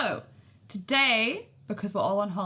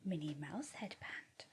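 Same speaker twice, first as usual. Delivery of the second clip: a breathy whisper, much softer, slow; whispered aside; 6 semitones lower, 17 dB quieter, slower than usual. Real, not acted. Mum whispers to baby about her Minnie Mouse headband.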